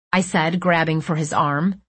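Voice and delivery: plain reading voice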